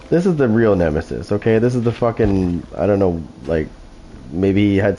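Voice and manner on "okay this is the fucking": normal talking voice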